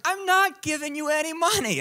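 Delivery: very high, imitated woman's voice; comic high-pitched stonewalling; mock exasperated refusal. Imitating woman's repeated refusal, escalated.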